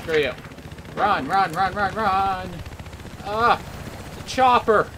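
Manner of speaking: panicked flight from a chopper; being chased. Real, not acted. Fleeing chopper in game.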